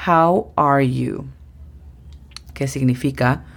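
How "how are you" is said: "How are you?" dicho despacio, en inglés claro; slow English model. Shown as a demonstration. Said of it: "es la famosísima frase". (pronunciación lenta de "How are you?")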